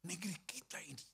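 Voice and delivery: hushed whisper for emphasis. Real, not acted. Pastor urging the congregation about the nation, whispered. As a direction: drop to a whisper to grip them.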